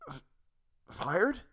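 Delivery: stammered, high, strangled croak; breathless, stunned croak. Acted. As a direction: force the word out with no air. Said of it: "He finally croaked the last word out".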